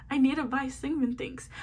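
ordinary delivery

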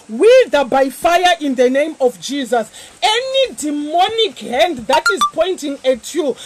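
loud, commanding prayer with fire; fervent preaching. Acted. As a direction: shout the declaration. Leads congregation in warfare prayer.

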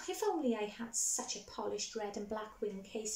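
ordinary delivery

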